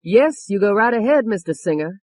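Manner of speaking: barely contained anger. A narrator's reading. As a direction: hold back anger while granting permission. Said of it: "could do to hold back her anger".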